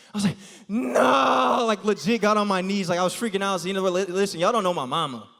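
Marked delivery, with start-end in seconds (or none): 0.7-1.7 mock wail. Hears girlfriend got caught.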